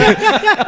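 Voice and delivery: bursting into a loud high laugh. Real, not acted. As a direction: crack up at the memory. Burst of laughter mid-story.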